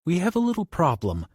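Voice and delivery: a nervous high warning, trying to stay calm. Acted. Johnny warns Bobby through the wall, high and nervous. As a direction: tell him the bad news lightly but tense.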